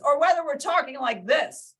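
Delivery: loud, high, emphatic delivery; loud tone demo. Shown as a demonstration. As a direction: project it big and high, as a contrast demo. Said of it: "completely different tone".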